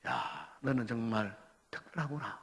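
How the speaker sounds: low, hushed words of admiration; softly impressed, pitched low; God's quiet admiration. Acted. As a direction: marvel softly, as God regarding a believer. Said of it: "하나님 아버지께서, 예수님께서".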